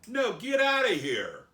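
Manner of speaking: loud, high mock outrage; hammed-up indignant shout; angry townspeople. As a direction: order him out like an aggrieved pig farmer. Townspeople angrily sending Jesus away.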